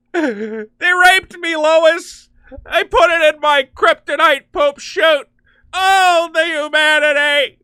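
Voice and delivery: high, loud mock wailing; hammed-up anguished Superman. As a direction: sob it out melodramatically to Lois. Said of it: "we're ad-libbing. We're improvising".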